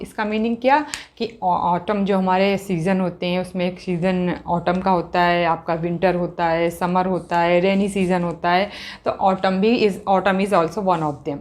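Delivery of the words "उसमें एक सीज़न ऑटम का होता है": normal talking voice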